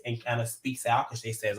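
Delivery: ordinary talk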